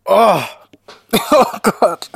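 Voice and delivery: high, loud disgusted outcry; disgusted exclamation. Real, not acted. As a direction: cry out at the awful taste. Reacting to awful taste.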